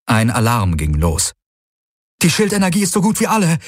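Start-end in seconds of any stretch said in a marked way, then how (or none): 2.2-3.6 high, rapid cry; urgent call. A narrator's reading. Crew member warns pilot the shields are failing, urgent call. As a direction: rush it out in a raised pitch. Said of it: "rief Kirai".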